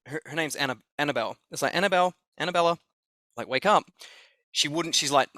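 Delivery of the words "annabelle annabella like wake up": quick repeated calling of the name, lightly voiced; calling her awake. Staff calling the sleeping woman to wake, quick and urgent. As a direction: rattle off her name to rouse her.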